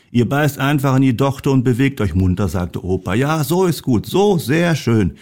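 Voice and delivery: high, singsong praise; cheery high-pitched instructions; encouraging coaching. A narrator's reading. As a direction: coax the little ones along, pitched well up. Opa coaching the little fish.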